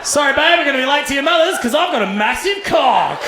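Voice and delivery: high, loud bragging bloke; proud boast. Retold or imitated. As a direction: brag like you've got nothing to hide.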